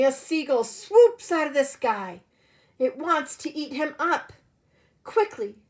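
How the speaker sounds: ordinary delivery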